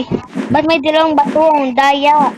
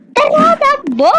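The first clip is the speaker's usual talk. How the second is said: high, slow panicked cry; panicked shout; 12 semitones higher, slower than usual. Real, not acted. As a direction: yell to run in panic. Panicked flight from snake. (takot na sigaw)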